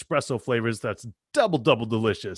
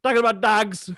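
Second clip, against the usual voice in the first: higher hammed-up Canadian accent; mock Canadian accent; 9 semitones higher, 4 dB louder than usual. Teasing his cohost, hammed-up Canadian accent. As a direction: lay the Canadian accent on thick. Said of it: "That was my Canadian accent".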